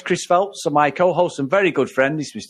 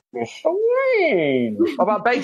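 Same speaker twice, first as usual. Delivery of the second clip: a slow, lightly voiced Bane; 7 semitones higher, much slower than usual. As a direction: drag it out slowly like Bane. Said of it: "does a better Bane".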